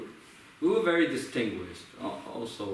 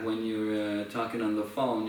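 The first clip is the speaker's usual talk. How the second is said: ordinary talk, nothing marked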